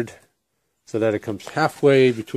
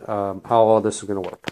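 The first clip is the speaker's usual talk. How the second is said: ordinary talk, nothing marked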